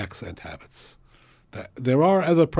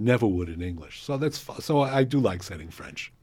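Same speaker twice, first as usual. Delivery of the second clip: ordinary talk, nothing marked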